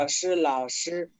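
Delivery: 是老师 drawn out slowly; slow Mandarin demo. Shown as a demonstration. Teacher repeats 是老师 slowly, syllable by syllable. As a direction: spell out 是老师 slowly for learners. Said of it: "这".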